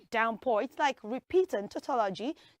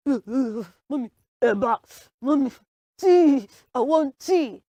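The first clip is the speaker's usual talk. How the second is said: acted shaking with cold; broken, shivery begging for tea; shivering; 6 dB louder than usual. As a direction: stammer out pleas to mommy, freezing. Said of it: "you're shaking".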